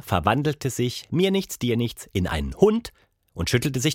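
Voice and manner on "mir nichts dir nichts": ordinary delivery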